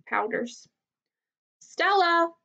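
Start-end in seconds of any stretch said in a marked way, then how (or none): 1.6-2.3 higher, calling across to a neighbour. A narrator's reading. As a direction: call her name across the enclosures. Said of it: "I call".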